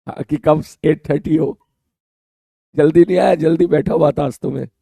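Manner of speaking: ordinary talk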